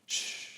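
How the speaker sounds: a hushing shh; soft shush. Shown as a demonstration. Pastor demonstrating a quiet shush. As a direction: hush someone gently. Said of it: "somebody that's saying".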